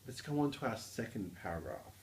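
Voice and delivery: ordinary talk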